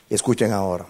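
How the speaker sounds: usual speaking voice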